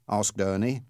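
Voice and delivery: plain reading voice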